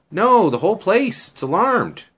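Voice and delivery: high, loud retold "no"; insistent objection. Girl telling him the whole house is alarmed, insistent. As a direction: shoot the plan down as the girl. Acted refusal of his climbing plan.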